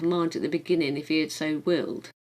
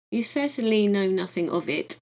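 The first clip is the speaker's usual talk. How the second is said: ordinary delivery, nothing marked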